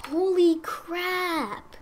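high, stretched-out amazement; stunned. Real, not acted. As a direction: squeak it out in disbelief. Amazed at gifts in chest.

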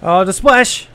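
high, loud exclamation; loud startled cry. Real, not acted. Player dodging a splash attack, crying out loud. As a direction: yelp at the near miss.

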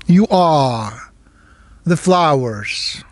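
meditation guide with long pauses between phrases; guided meditation. Real, not acted. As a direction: give each phrase weight, let it fall, then leave silence.

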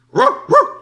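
high, loud barking ruh ruh; dog bark. A narrator's reading. (abbaio di cane)

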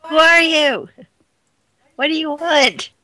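loud, high calls into the water; shouting. Acted. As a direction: shout down at the voices.

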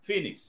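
Phoenix said as FEE-niks; correct pronunciation. Shown as a demonstration. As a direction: model Phoenix with a long ee and a falling end. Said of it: "That's how it is pronounced".

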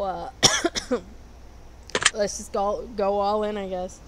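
ordinary delivery

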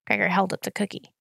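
usual speaking voice

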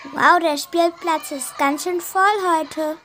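ordinary talk

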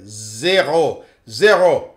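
put-on zero with a light French accent; French-accented, dismissive quote. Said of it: "the French accent came out".

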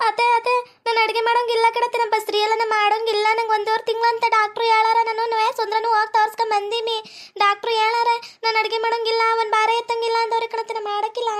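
high-pitched, put-on weepy whine; mock-crying complaint to the mother-in-law; tearful whining. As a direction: plead in a sustained high wail. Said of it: "ನನ್ ಹೆಂಡ್ರು ನೋಡು ಹೆಂಗ್ ಅಳಕತ್ತಾಳ".

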